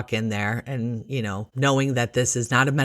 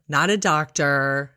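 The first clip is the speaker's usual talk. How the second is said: usual speaking voice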